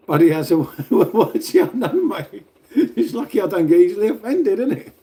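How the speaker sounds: loud, high laughing reply; amused, laughter bubbling through speech; laughing through the words. Real, not acted. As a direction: laugh it off, pitch climbing. Amused by Buddy's insult.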